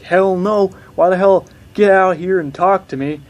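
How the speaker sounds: heated plea; upset retort. Retold or imitated. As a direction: refuse to go and demand she come out. Refusing to leave, upset.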